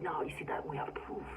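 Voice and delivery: hushed mocking whisper of their claim; sneering whispered quote. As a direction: breathe it like a smug secret. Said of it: "they're like".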